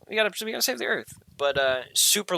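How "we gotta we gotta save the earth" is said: high, fast mocking chant of a slogan; squeaky send-up of an eco slogan; mock activist. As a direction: parody her preachy cause, breathless and high.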